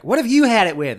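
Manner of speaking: high, loud mock question; mock cohost asking. Second host asking her partner, high loud mock chirp. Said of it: "the other one's like".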